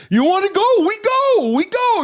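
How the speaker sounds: put-on high French-accented dare to race; imitating a French driver's challenge. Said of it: "with that French accent".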